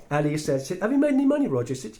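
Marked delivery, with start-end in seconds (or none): 0.8-1.7 much higher and louder, playful; teasing call-out. Retold or imitated. Tish to Roger in front of the doubter, loud playful. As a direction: show up the doubter.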